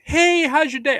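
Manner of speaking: mock the over-the-top waiter who irritates you; fake friendly greeting. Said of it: "When you go to the whole".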